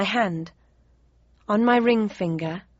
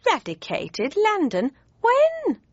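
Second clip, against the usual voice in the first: disbelief rising to a yelp; incredulous; 10 semitones higher, slower than usual. Acted. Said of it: "asked my mother incredulously".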